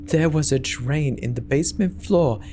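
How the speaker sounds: ordinary delivery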